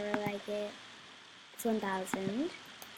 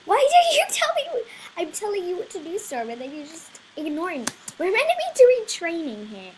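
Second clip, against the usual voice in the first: high, loud exasperated telling-off; hammed-up frustration at the horse; mock scolding; 6 semitones higher, 12 dB louder than usual.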